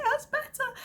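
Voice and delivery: put-on high little child voice; small boy saying it's better; toddler voice. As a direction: imitate a relieved toddler. Toddler answers mum.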